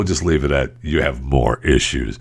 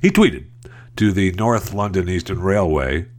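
ordinary talk, nothing marked

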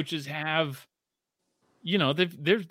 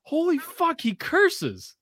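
shocked realization; 13 semitones higher, slower than usual. Retold or imitated. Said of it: "I'm like".

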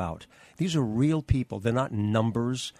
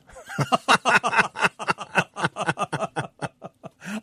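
long, high staccato laugh; hearty laugh; 10 semitones higher, 5 dB louder than usual. Real, not acted. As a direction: burst into a loud, high cackle. Laughs recalling the absurd name suggestion.

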